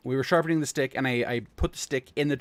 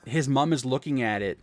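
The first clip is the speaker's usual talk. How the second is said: ordinary talk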